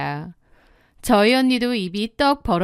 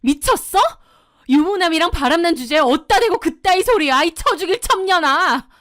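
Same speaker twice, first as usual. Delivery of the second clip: high, fast outburst of fury; enraged screaming; 7 semitones higher, 4 dB louder than usual. Acted. Wife exploding at her husband's mistress, enraged yell. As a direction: lose it and yell the insults. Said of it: "완전 눈이 돌아서 소리를 질렀는데".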